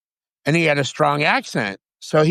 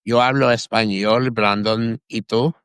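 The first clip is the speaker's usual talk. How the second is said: casual Spanish. Real, not acted. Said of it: "Oh, yeah, Spanish, español".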